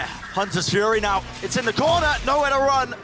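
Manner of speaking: excited play-by-play call; esports caster. Real, not acted. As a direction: call the action live.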